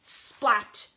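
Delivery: punchy splat effect; splat sound. Acted. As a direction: smack it out.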